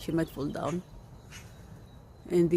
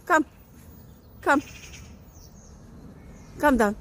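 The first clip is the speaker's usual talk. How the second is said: coaxing call; 8 semitones higher than usual. Real, not acted. Coaxing cat down.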